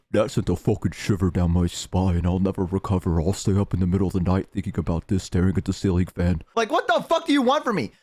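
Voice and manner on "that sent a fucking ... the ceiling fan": very low put-on viewer voice; disturbed viewers. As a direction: imitate haunted fans, pitched way down. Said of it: "and you guys go".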